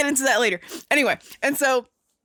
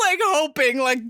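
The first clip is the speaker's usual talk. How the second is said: normal talking voice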